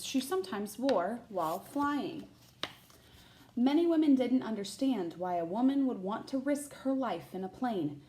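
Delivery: put-on bright, lively read-aloud voice for children; picture book narration; storybook reading. A narrator's reading. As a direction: read it like story time for a class.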